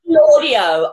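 confident CLAW-dee-oh for "Claudio"; preferred mispronunciation. Shown as a demonstration. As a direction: say "Claudio" boldly with a "claw" vowel. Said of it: "I like to say".

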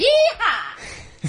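high whooping cowboy call; a playful yeehaw at the festival mention. Pretend. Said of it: "Sorry, I couldn't resist".

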